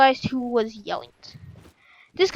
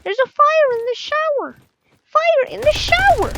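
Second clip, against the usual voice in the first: high panicked yell; 4 dB louder than usual. Retold or imitated. Half-asleep boy yelling the misheard warning, high alarmed shout. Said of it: "he took it as".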